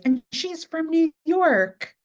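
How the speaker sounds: high, drawn-out mock announcement; mimicked excited announcement. Girls pointing her out to an actor, mimicked excited announcement. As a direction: imitate them showing someone off, high and slow.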